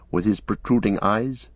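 ordinary delivery

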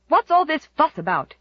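sharp, irritated demand. A narrator's reading. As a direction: snap it out impatiently. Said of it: "demanded Aunt Mary Maria".

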